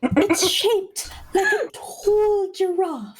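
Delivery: very high, slow posh British voice with laughs; posh British accent. Pretend.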